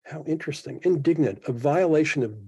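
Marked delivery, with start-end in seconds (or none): 0.0-0.8 surprised inner voice. Retold or imitated. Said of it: "I thought".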